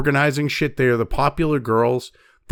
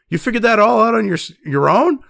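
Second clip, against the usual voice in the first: retold surprise, higher on the opening words; unsarcastic surprise; 6 semitones higher than usual. Coworker reacts to his guess about her sister, retold surprise. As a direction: imitate a genuinely amazed question, pitched up. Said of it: "not sarcastically figured out on your own".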